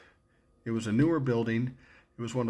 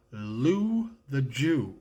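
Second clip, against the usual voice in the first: announced stage name; 3 semitones higher, much slower than usual. Retold or imitated. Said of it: "On the air, I'm gonna use the name".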